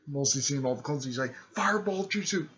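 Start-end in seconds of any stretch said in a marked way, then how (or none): 1.5-2.5 high, raised battle call; called-out attack name. Retold or imitated. Ninja retold calling his fireball attack, called out high. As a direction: imitate a fighter announcing an attack.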